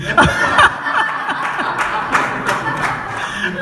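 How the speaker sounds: a long, breathy, high burst of laughter. Real, not acted. Telling the church about the wife's plea, long high breathy laugh. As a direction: laugh helplessly at the absurd request.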